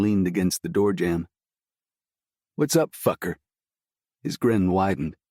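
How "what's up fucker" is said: cheeky friend. A narrator's reading.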